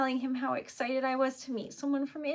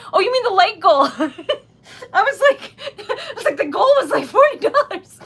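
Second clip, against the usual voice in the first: loud, high, laughing disbelief; surprised laughter; 7 semitones higher, 16 dB louder than usual. Real, not acted. Streamer realising which goal was meant, astonished and laughing. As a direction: burst out laughing as you talk.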